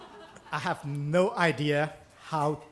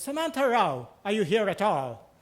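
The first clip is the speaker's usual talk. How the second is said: "Samantha Rao" called out higher and louder; calling out to the audience; 3 semitones higher, 5 dB louder than usual. Retold or imitated. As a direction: call Samantha Rao across the room, impatient. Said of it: "looks at his notepad one more time and says".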